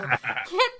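ordinary talk, nothing marked

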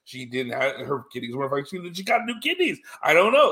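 mock-baffled doctor, pitch jumping high; freaked out. Doctor unable to explain the new kidneys, freaked out. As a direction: act out a flustered doctor at a loss. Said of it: "the doctors are freaked out".

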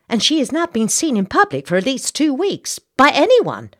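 high, loud, anxious society lady; a matron pressing the captain to act; fretful Southern belle. Acted. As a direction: wring your hands over poor Elizabeth. Missus Bartlett frets over Elizabeth.

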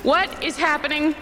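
high-pitched panic without volume; mock scream. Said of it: "Dylan starts screaming".

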